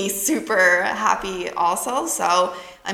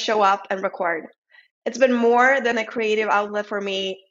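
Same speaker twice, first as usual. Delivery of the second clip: upbeat sponsor read, steady and polished. Acted. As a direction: read it like a podcast ad.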